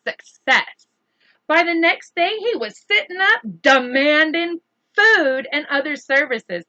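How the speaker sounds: put-on animated read-aloud for children; lively storybook reading; story narration. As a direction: read it like bedtime story time.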